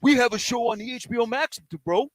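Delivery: mock Laker fan. Mocking Laker fans on air.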